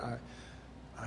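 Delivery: whispered, broken off; despairing whisper. Retold or imitated. Retelling his despairing prayer, in a whisper. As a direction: barely get the word out.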